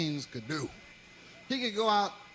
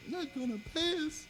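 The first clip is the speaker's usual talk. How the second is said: crying lady; 8 semitones higher, 6 dB quieter than usual. Retold or imitated.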